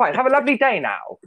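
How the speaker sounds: chirpy Doctor chatter while serving at the till; Matt Smith impression. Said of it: "talking like Matt Smith the entire time".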